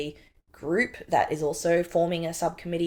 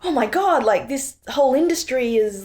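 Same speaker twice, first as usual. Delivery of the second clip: shocked realisation; 7 semitones higher, 5 dB louder than usual. Retold or imitated. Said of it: "shocked and fearful".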